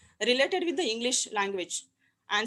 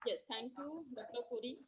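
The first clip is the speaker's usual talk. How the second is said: very faint call audio; softer, as if over a weak line; barely audible; 13 dB quieter than usual. Real, not acted. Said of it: "Hello, madam, you are not audible".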